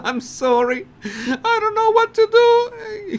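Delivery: very high, laughing half-sob apology; mock whimper. Harry apologising to Colbert, mock whimpering very high. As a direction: whine it like a caught schoolboy.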